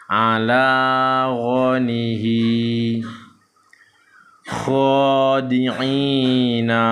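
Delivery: slow, drawn-out Arabic syllables on an even tone; measured Quran-reading demonstration; Iqra recitation drill. Shown as a demonstration. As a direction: chant each syllable long and steady. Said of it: "kita akan lanjutkan membaca".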